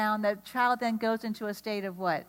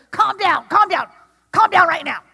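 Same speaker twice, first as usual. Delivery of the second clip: loud, high, exasperated repeated shout; stressed parent yelling; 8 semitones higher, 13 dB louder than usual. Retold or imitated. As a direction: snap at a melting-down kid in a store. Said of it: "You can't yell at someone to calm down".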